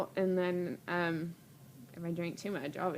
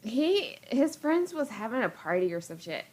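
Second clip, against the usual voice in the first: ordinary talk, nothing marked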